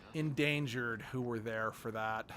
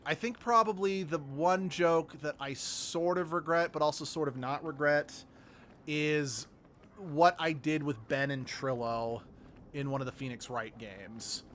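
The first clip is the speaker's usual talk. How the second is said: normal talking voice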